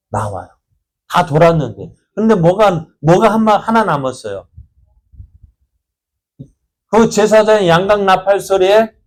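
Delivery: ordinary delivery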